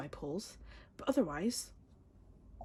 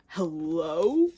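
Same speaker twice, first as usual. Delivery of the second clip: loud disbelieving hello; loud mock-indignant hello; incredulous hello; 9 dB louder than usual. Real, not acted. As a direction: call out in disbelief. Incredulous at pull.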